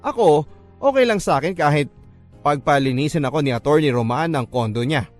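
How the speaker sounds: ordinary delivery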